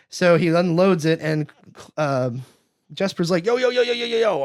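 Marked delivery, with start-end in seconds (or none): 3.5-4.4 mock panic, high-pitched; high, hammed-up alarm; freaking out. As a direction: imitate someone freaking out, high and rapid-fire. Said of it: "like, freaking out".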